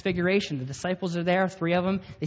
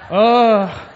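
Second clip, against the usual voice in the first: mock startled oh, much louder and higher; caught-out oh; 5 semitones higher, 14 dB louder than usual. Boys caught plotting by the girl's dad, startled loud oh. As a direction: blurt it like a kid caught red-handed.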